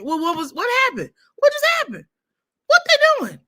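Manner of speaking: high, rising string of nagging questions; pestering questions. Retold or imitated. Imitating her family nagging her while she watches the game.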